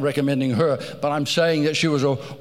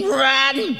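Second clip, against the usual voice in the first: mock scream; 8 semitones higher, 3 dB louder than usual. Body screaming run.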